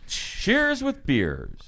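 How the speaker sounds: very slow, high and sung out; drawn-out sign-off. Acted. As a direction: stretch the words out high.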